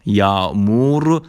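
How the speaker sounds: "یامور" very slow, as Turkish yağmur; silent ğ demo. Shown as a demonstration. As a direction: stretch "یامور" into yaa-moor.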